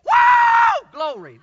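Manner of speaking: whooping high and loud; real shout. Real, not acted. Preacher shouting to the congregation already, loud. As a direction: let out a joyful whoop. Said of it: "I'm already shouting".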